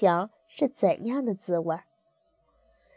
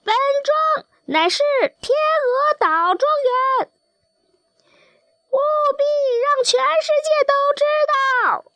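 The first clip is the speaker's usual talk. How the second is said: crowing rooster. A narrator's reading. Another rooster proclaiming his manor to the world, high crowing. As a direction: crow it for all the world to hear. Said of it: "另外一只公鸡也啼叫着".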